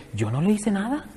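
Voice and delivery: a hint of a sulky child protesting innocence; child's denial. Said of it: "siempre que sale llorando el otro dice". (niño quejándose que no hizo nada)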